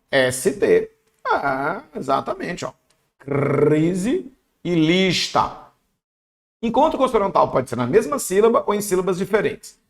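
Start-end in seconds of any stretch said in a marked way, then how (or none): 3.2-5.6 long rolled R in "crise", held S in "lista"; exaggerated clusters. Shown as a demonstration.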